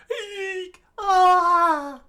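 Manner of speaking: short eek, then loud falsetto scream; high screams. A narrator's reading. Nami and Usopp see the giant scream, shrieking. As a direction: shriek in cartoon terror. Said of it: "Nami and Usopp screamed".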